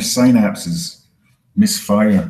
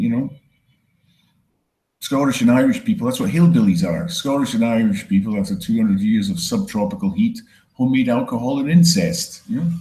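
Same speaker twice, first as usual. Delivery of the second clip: usual speaking voice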